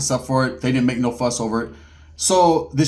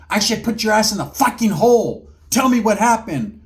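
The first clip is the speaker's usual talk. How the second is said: angry threat; 6 semitones higher than usual. Retold or imitated. Officer threatening to put him in the hole, angry. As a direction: threaten him, slowing on the demand.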